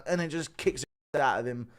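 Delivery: normal talking voice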